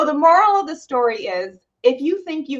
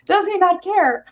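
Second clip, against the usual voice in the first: worked-up rant; 9 semitones higher than usual. Retold or imitated. Worked-up rant about husband.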